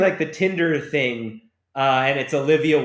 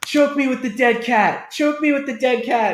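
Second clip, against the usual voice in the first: high mock phone-sex voice; mocking sultry caller; 10 semitones higher, 4 dB louder than usual. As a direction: camp it up, breathy caller. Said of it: "He's like".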